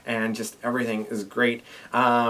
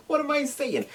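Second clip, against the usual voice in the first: squeaky high-pitched voice; high squeaky repeat. Real, not acted.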